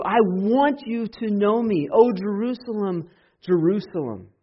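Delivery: high, loud impassioned preaching; fervent pulpit preaching; preaching at full force. Acted. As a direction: pour out the lament at the top of your voice.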